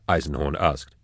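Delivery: ordinary delivery, nothing marked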